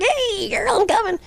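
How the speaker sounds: very high squeaky cartoon falsetto; creepy old man piping up in a tiny cartoon voice; Mickey Mouse. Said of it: "He talked like Mickey Mouse".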